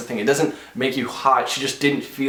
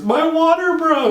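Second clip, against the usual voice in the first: much higher, slow, panicky mimicry of her wife; mock panicked wife; 7 dB louder, slower than usual. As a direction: squeal it like a frantic wife. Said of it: "All she says is".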